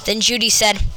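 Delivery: ordinary delivery, nothing marked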